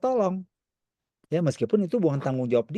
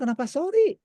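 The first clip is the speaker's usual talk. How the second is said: surprised question; 13 semitones higher than usual. Retold or imitated. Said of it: "Terus saya bilang".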